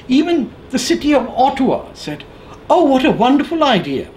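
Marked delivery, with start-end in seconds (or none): 2.7-4.1 sarcastic, pitched up high, sing-song praise; high mocking "wonderful idea"; mock gushing approval. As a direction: mimic a naive official gushing. Mayors' reply mocked in quote.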